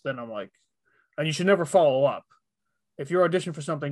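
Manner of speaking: ordinary talk, nothing marked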